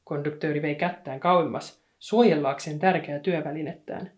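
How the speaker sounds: ordinary delivery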